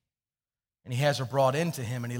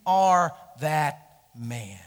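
slow prophet's verdict; 7 dB louder, much slower than usual. Retold or imitated. Prophet accuses David.

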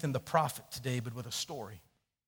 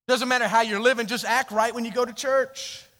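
higher and louder mock of fake churchgoers; preaching at full force; 9 semitones higher, 10 dB louder than usual. Real, not acted. Pastor loudly mocking churchgoers who only act right. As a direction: pound it out from the pulpit.

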